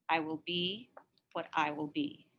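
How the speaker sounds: hushed, breath held back; quiet resolve. A narrator's reading. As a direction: say it quietly to herself. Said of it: "she said to the empty room".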